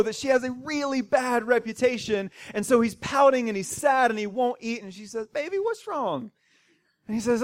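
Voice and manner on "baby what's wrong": put-on higher, coaxing baby-talk question; lightly voiced wife cooing at her husband; sweet-talking wife. Jezebel asks her sulking husband what's wrong, higher and coaxing.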